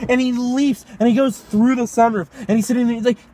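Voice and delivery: ordinary talk, nothing marked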